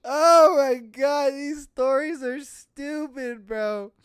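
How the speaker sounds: high, slow, drawn-out disbelief; loud groaning outburst after laughing; amused exasperation. Real, not acted. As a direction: wail in delighted despair. Recovering from laughing fit.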